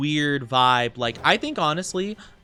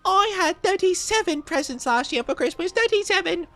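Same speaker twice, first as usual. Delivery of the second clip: put-on bratty spoiled boy. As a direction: demand presents like a brat. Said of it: "And he's like".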